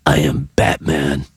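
very low Batman growl; deep, rough superhero impression. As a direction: drop way down into a Batman voice. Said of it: "I was doing Batman's voice".

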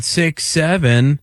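slow, sing-song six seven; lightly voiced drunk guy; drawn-out answer. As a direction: stretch it out like the meme. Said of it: "He said".

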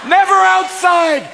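exasperated shout. Acted. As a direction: blurt it out in disbelief. Exasperated outburst.

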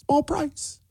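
tiny high-pitched mock voice; mocking echo. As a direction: chirp it softly, dripping with sarcasm. Mocking a played clip's phrase.

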